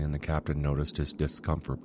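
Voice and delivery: ordinary delivery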